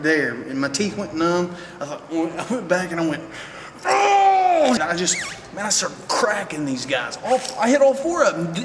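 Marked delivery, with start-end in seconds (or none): 3.9-4.7 high loud yelp of pain; pained shout. Retold or imitated. Retelling the punch in the mouth, loud pained yelp. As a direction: cry out as the punch lands.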